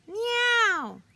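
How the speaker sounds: high, loud meow; a loud, high cat cry. A narrator's reading. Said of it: "But Stevenson said".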